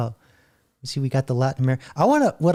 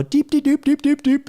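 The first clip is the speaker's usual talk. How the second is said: quick high, louder run of repeated syllables; mock gibberish speech; 12 semitones higher, 4 dB louder than usual. Host mimicking a caller whose speech gets converted, high mock gibberish.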